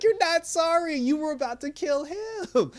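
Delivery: very high-pitched protest; exasperated retort. Acted. Viewer rejects villain's apology to Sam, exasperated retort. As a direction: squeal back in comic disbelief.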